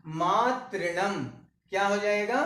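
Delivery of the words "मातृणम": slow demo. Shown as a demonstration. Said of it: "तो ये जब दीर्घ बन गई तो हो जाएगा क्या?".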